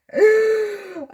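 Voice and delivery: loud, high gasp fighting back tears; sharp emotional gasp, high-pitched; teary gasp. Real, not acted. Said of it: "I'm getting teary-eyed".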